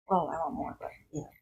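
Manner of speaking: soft aside whisper. Real, not acted. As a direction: let it slip out under the breath.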